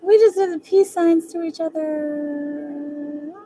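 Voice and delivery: high, slow, drawn-out giddy squeal of "We just did the peace signs". Real, not acted. Repeating chat's story, high slow giddy squeal. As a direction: savor it like a swoon.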